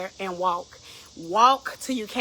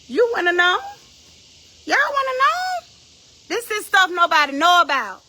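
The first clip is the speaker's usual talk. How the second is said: high, raised-voice preaching; loud challenge; 7 semitones higher, 5 dB louder than usual. Real, not acted. Preacher asking listeners if they wanna know, loud challenge. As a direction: call it out to the whole congregation.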